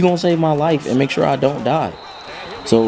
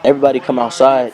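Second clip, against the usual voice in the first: ordinary talk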